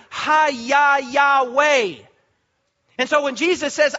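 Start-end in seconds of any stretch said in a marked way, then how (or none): 0.1-2.0 Hayah Yahweh drawn out very slowly, each syllable spaced as ha-yah yah-weh; slow pronunciation demo. Shown as a demonstration. Preacher showing the congregation how Hayah echoes in Yahweh, said very slowly. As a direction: stretch Hayah and Yahweh slowly so the shared sound rings. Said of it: "Listen to the similarity".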